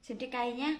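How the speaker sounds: usual speaking voice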